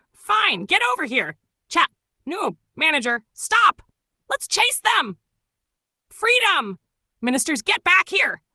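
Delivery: loud high chase shouts; shouting. Acted. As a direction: yell it out mid-chase.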